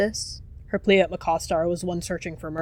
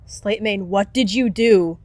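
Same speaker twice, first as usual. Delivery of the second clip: louder, slow horrified question; horrified demand; 3 semitones higher, 7 dB louder, slower than usual. Acted. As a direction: demand it, shaken. Horrified apprentice demands answer.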